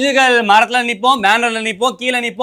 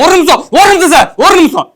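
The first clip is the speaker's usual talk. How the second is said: fast, louder, higher pleading; frantic; 5 semitones higher, 5 dB louder than usual. Acted. Fan begging the women to let him show his sandal, frantic. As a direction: rush to stop them, one minute, one minute.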